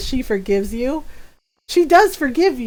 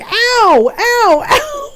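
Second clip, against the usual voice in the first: high, louder yelps of ow; mock pained yelp; 10 semitones higher, 4 dB louder, much slower than usual. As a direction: yelp comically as if being whacked. Retelling brother getting whooped.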